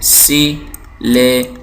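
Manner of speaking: सिलेबल very slowly as si - le - bal; long pause after each syllable of सिलेबल; three syllables apart. Shown as a demonstration. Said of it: "इस लफ्ज़ के अंदर तीन सिलेबल हैं".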